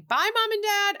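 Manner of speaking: put-on high sing-song teen farewell; cheery goodbye. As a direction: chirp it on the way out the door. Wendy leaving for homecoming.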